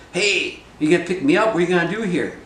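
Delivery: high, loud protest at the departing bird; calling out. Acted. Winnie Bijou shouting after the bird that left him, loud high call. Stranded trickster calls to bird.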